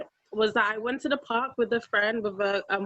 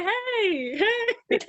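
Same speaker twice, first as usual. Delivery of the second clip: bright sing-song hey; cheerful high greeting; 10 semitones higher than usual. Retold or imitated. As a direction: call out a happy hello. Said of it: "and I'd say".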